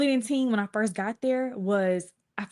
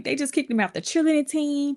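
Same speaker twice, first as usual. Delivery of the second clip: high, plaintive retold wail; imitated upset, pitched up; retold hysterical crying; 7 semitones higher than usual. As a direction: report it to mom as if in tears. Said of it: "I'm crying hysterically".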